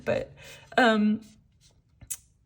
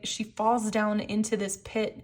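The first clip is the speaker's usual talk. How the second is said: normal talking voice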